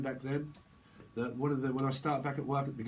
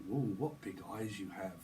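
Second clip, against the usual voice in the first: lightly voiced wolf; 5 semitones lower, 6 dB quieter than usual. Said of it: "the wolf or something".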